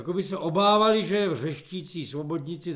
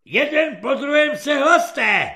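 high, loud corporal's command; shouted order; 9 semitones higher, 9 dB louder than usual. A narrator's reading. Corporal orders recruits to report, loud and high. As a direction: call the roll at a high yell.

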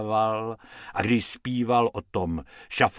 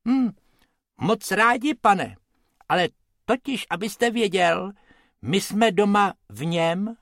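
small rabbit voice; 8 semitones higher than usual. Acted. Rabbit Bobek speaks.

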